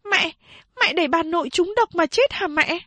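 put-on high, pleading child's voice; frightened young girl. A narrator's reading. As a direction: ask in alarm like a scared child. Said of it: "Chị ba hỏi". (chị ba hỏi mẹ, giọng bé gái hoảng sợ)

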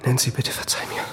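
whispered apology; hushed plea. Acted. Steve begging his injured wife's forgiveness, whispered.

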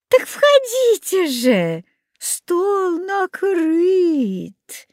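high, very slow crooning; sugary luring. A narrator's reading. Old woman urges children to her table, sugary luring. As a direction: sing out the invitation, stretching the last words.

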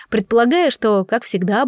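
ordinary delivery

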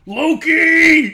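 huge high-pitched drawn-out yell; full-blast, fist-shaking holler; furious Thor. Acted. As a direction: bellow the name skyward in mock fury. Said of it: "shakes his fist at the air".

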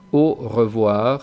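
"au revoir" slow, with a closed French O in "au". Shown as a demonstration. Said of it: "tirando hacia U". ("au revoir" lento, con O cerrada francesa)

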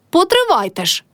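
high-pitched mild storybook yell; narrator's restrained version of a shout; crying out. A narrator's reading. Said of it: "крикнула Мотря на весь город".

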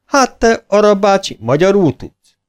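high, amazed question. A narrator's reading. Soldier amazed the bear speaks Hungarian, high surprise. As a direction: marvel at him.

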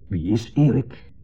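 offhand, slow question; casual. Retold or imitated. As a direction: ask as if about an old friend. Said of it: "En toen weer terloops". (terloops, nonchalant)

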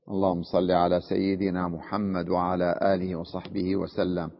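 low, soft prayer under the breath; murmured blessing. Real, not acted. Teacher pausing to bless the Prophet, murmured low. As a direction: drop right down into a quiet, level recitation.

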